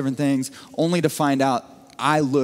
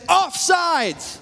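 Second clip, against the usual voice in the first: high, strained yell of an angry defender; hammed-up shouting at the referee, much higher; mock yelling opponent; 13 semitones higher, slower than usual. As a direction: squawk it at the ref like the guy guarding you. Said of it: "he kept saying".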